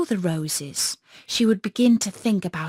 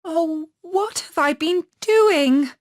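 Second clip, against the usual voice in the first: high, slow and louder, waking with alarm; a child realising her mistake; little girl's dismay; 8 semitones higher, 4 dB louder than usual. Acted. Gerda in dismay as she remembers Kay. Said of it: "said the little girl".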